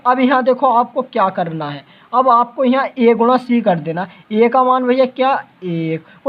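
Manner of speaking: usual speaking voice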